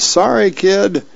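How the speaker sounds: drawn-out, high "sorry"; calling out apology. Acted. Little sister to a faraway kid at water's edge, calling an apology. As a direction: call it slowly out over the water. Shocked girl apologises across the ocean.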